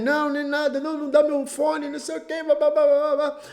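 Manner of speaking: put-on high, whining child's protest; whiny kid. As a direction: mimic a sulky boy whining back. Son whines back at his father.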